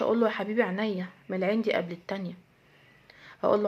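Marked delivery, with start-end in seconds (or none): 0.3-2.3 put-on tender reply to child. Mother answering her child tenderly.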